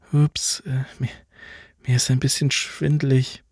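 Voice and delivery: a hint of a dizzy girl getting up too fast; dizzy [name]. [name], unsteady after getting up, faltering lightly.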